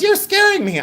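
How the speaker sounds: high-pitched mock fright; imitating scared mom. Mother answering a creepy prank call, imitated high fright. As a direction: squeal it as a frightened mother would. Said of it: "And she goes".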